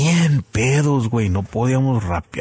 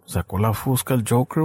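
usual speaking voice

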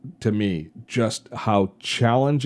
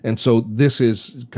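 usual speaking voice

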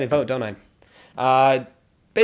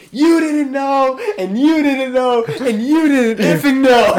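higher, louder, slow gleeful chant at each person; gleeful taunting; 9 semitones higher, 6 dB louder, slower than usual. Retold or imitated. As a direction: drag out every word, thrilled to finally spill the secret. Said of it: "He's so excited".

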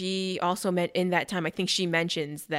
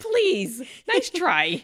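mocking dismissal; 6 semitones higher, 4 dB louder, much slower than usual. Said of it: "And I'm like".

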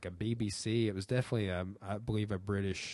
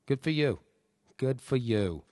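Scorsese impression; 5 semitones higher, 6 dB louder than usual. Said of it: "Channeling your Scorsese".

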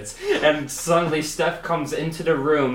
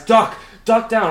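sudden loud shouted warning, raised high; guide's shout; 10 semitones higher, 8 dB louder than usual. Retold or imitated. Tour guide shouting at the hikers to duck. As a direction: bark the order to get down fast. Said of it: "the guy's".

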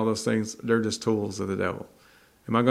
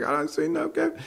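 much higher, offhand bystander voice; dismissive townsperson. Retold or imitated. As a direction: brush the old man off. Townsperson answers farmer.

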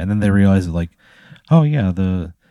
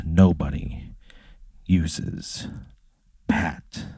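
through gritted teeth; 2 semitones lower, 4 dB quieter, much slower than usual. Acted. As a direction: hold back the anger and grind it out through your teeth. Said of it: "through gritted teeth".

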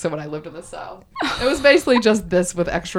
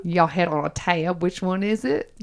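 put-on Southern accent; 4 dB quieter than usual. Host quizzes co-host on their show in a Southern accent. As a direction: quiz her in a playful Southern accent. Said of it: "in my Southern accent".

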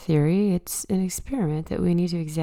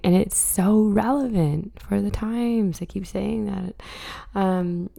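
usual speaking voice

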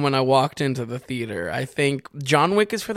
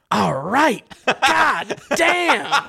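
higher-pitched mock outburst breaking into a laugh; imitated exasperated moviegoer; 9 semitones higher, 3 dB louder, much slower than usual. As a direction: call it out like a fed-up man in a theater. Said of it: "he said it like that".

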